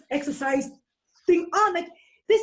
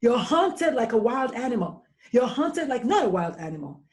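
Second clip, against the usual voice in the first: ordinary talk, nothing marked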